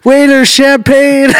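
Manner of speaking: high, slow mock holler; drawn-out high-pitched ordering; celebratory call to waiter. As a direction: imitate a mom calling out across a restaurant. Mom celebrates, calls the waiter.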